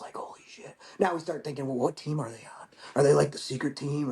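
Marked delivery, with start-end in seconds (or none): 0.0-0.7 hushed, breathless holy shit; a stunned whisper at seeing seals; awed whisper. Real, not acted. Awed at meeting Navy seals. 1.7-4.1 hushed, secretive wondering; a mock-secret whisper among the guys; conspiratorial whisper. Wondering if seals are secret.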